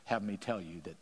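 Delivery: hushed, falsely spiritual advice; pious gossip voice. Retold or imitated. Preacher mimicking a churchgoer's pious gossip. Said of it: "We say to people".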